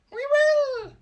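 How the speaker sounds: very high, very loud squeaky shout; shrill chorus of mice. Acted. Mice promising their queen to obey, a shrill chorus. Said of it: "cried all the mice in a shrill chorus".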